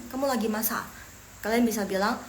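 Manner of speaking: ordinary talk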